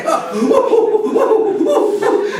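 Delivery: mock boyish cackle; high giggling laughter. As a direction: laugh at him like naughty boys. Boys laughing.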